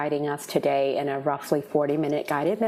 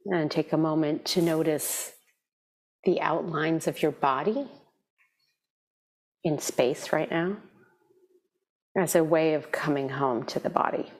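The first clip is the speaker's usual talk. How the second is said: guided meditation. Real, not acted. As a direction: ease them gently into stillness, leaving space between phrases.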